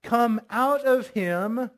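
drawn-out commanding rebuke; stern command. Acted. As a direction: cast it out sternly, word by word. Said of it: "said Jesus sternly".

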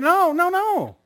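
put-on high, loud panicked kid pleading; pleading son. Young son begging dad not to spank him again. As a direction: beg like a little boy dodging a spanking.

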